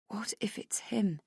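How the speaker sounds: breathy whispered dread; whispered fear. Acted. Narrator at the mirror whispering her fear. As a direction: whisper it to yourself, afraid. Inner fearful thought.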